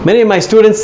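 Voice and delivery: usual speaking voice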